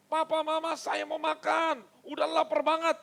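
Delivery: put-on loud, high hungry-child yell; mock shriek of a hungry kid demanding food; imitated child's wail. Hungry children screaming to their parents, mock shrieking. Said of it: "kalian jerit-jerit sampai berkata".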